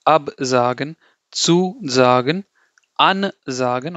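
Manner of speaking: didactic, prefix punched and word drawn out; emphasized prefix. Shown as a demonstration. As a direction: stress AB, ZU and AN, each split off from sagen. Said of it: "you emphasize it. So you say".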